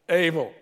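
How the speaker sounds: able hit high and loud, falling hard; emphatic able. Real, not acted. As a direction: land the word able like a hammer. Pastor punches key word.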